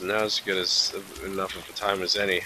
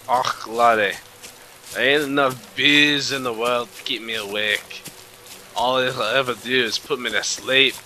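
playful put-on Scottish accent; 3 semitones higher than usual. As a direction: put on a hammy Scottish lilt. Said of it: "Scottish accent, laddie".

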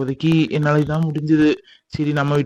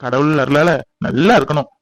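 normal talking voice

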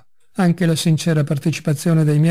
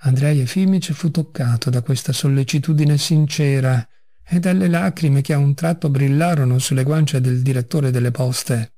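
plain reading voice

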